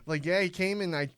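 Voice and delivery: Fargo gas station clerk; Minnesota accent. Pretend. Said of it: "do a Minnesota accent like that".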